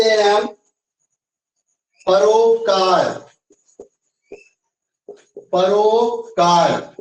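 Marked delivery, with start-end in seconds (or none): 2.1-3.1 परोपकार said slowly, syllable by syllable; teacher dictating परोपकार at a slow pace; slow dictation. Shown as a demonstration. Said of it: "ये कैसे बनेगा?". 5.5-6.9 परोपकार drawn out very slowly, each syllable apart; teacher repeating परोपकार very slowly; very slow dictation. Shown as a demonstration. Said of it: "ये कैसे बनेगा?".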